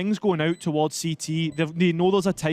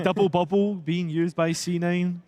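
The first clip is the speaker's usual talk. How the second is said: real Scottish accent, casual delivery; Scottish-accented game commentary. Real, not acted. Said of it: "said in a Scottish accent".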